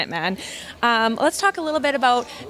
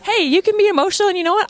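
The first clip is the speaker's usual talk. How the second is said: quick, much higher, bright-eyed encouragement; excited reassurance; 8 semitones higher, 3 dB louder, faster than usual. Real, not acted. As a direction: cheer her up fast, pitch jumping way up. Host cheering up emotional winner.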